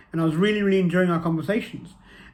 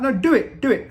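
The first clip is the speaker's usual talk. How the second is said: higher, louder pushy friend; insistent urging; 8 semitones higher, 5 dB louder than usual. Retold or imitated. Friend urging him to message the girl, pushy and louder.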